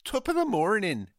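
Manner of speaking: high, loud stage-Irish; mock Irish accent. Shown as a demonstration. Podcaster rehearsing a way into Irish, high mock accent. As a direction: practice a lilting Irish greeting, badly. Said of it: "how to do an Irish accent".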